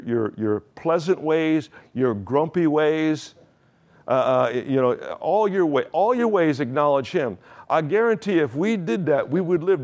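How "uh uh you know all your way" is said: normal talking voice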